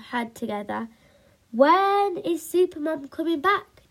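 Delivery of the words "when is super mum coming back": put-on eager boy, high and loud; thrilled child demanding an answer; bouncing-with-excitement question; excited demand. A narrator's reading. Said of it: "demanded Spike, so excited".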